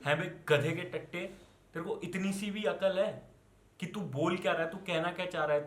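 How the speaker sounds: normal talking voice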